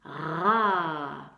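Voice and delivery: Ra said alone and firm; isolated syllable. Shown as a demonstration. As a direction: stress the first syllable Ra. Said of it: "c'est la première syllabe".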